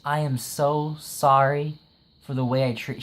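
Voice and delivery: ordinary talk, nothing marked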